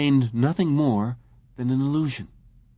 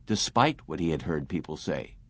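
usual speaking voice

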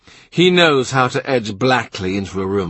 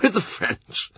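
chuckling; 10 semitones higher, 4 dB quieter than usual. A narrator's reading. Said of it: "he chuckles".

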